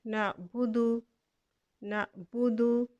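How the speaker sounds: नाबुदु slow, final दाल with its u; drawn-out नाबुदु, the ending voiced; recited word. Shown as a demonstration. Teacher recites the joined form slowly for students. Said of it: "दाल के ऊपर पेश है".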